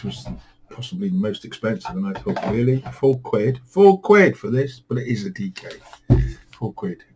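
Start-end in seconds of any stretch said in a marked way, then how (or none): 2.5-4.8 retold disbelief, rising higher and louder; incredulous. Recalling thoughts on an expensive book, incredulous. Retold shock at a book's price.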